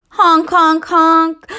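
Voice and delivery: loud goose honking. Acted. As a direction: honk like a goose.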